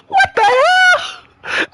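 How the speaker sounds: very high, slow wail of disbelief; squealing what the hell; shocked. Real, not acted. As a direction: stretch out the outrage. Outraged reaction.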